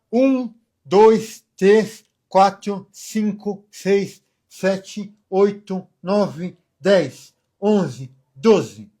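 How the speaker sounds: counting with a pen between the teeth. Shown as a demonstration. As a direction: bite a pen and count clearly. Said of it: "segurar com os dentes".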